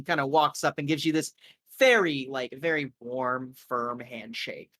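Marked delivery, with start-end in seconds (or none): none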